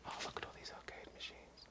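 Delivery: hushed whisper, sneaking around; breathy secret whisper. Real, not acted.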